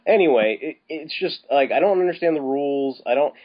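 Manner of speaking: ordinary talk, nothing marked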